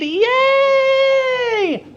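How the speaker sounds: high, bright burst of joy; delighted cheer. Real, not acted. Teacher cheering a student's insight, excited.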